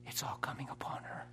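very soft, whispery imitation of the devil; accusing inner voice. Preacher voicing the spirit during his wife's seizure, accusing inner voice. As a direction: drop almost to a whisper as the tempter. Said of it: "his voice was saying".

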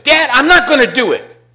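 loud, high defiant refusal. Shown as a demonstration. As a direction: shout it back like a rebellious son. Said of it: "a little more emphatic".

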